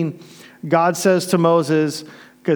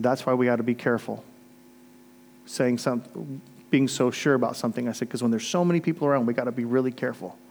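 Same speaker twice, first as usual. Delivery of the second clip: soft gentle lesson; 4 semitones lower, 6 dB quieter than usual. Retold or imitated. Softly teaching the girl.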